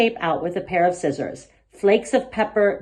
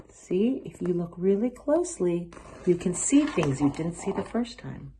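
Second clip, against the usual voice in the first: gentle hush; 6 dB quieter than usual. A narrator's reading. Grandma gently explains.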